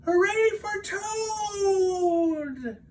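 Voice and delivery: high, slow cheering cry; small shout. Acted. Frog cheering Toad from the audience, high shout. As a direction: cheer from far back in the audience. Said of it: "as he shouted".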